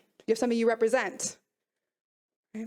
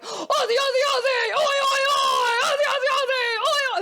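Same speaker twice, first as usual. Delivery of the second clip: high, loud Australian sports chant; hammed-up shouted Aussie crowd cheer; crazy Aussie fans; 11 semitones higher, 5 dB louder than usual. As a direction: belt it out like nutty Aussie supporters. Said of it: "they are nuts".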